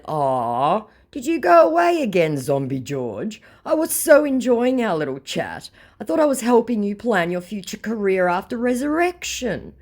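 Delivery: high, sing-song fake sweetness; mock sweet taunt. Teasing the scammer she's baiting, in a sugary high mock voice. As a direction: coo it at him like a teasing friend.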